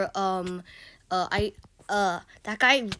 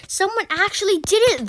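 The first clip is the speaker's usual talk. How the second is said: loud protest; 9 semitones higher, 9 dB louder than usual. Retold or imitated. Protesting to the teacher.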